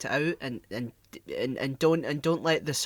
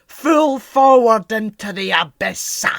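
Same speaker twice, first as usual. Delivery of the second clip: loud, slow pirate captain, last word ending in "ah"; hammed-up roar, stretched out with a tacked-on "ah"; Davy Jones; 5 semitones higher, 11 dB louder, slower than usual. Imitating a Scottish-accented film villain, booming and drawn out. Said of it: "He'll say, like".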